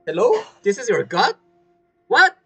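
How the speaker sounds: normal talking voice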